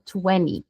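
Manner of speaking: "twenty" said as twenny; American TWENTY, silent T. Shown as a demonstration. Teacher shows what people mostly say, twenny without T. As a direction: drop the second T of "twenty". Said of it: "Ignora o T".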